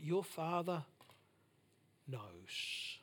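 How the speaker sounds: dropping to a near-hush, slow and gentle; hushed tender. Acted. Preacher softly assuring the church of God's care. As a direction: lay it down softly like a comfort.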